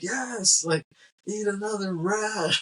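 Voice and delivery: imitating a delighted boss; gleeful egging on. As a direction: urge him on like a thrilled ringleader. McKay egging him on.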